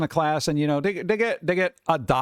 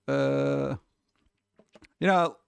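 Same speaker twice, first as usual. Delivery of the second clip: ordinary talk, nothing marked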